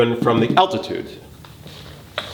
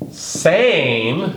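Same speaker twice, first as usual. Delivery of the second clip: same, raised and drawn out; stressed word; 6 semitones higher than usual. Shown as a demonstration. Teacher to class, stressing same. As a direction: lean hard on same. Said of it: "I wanna emphasize same".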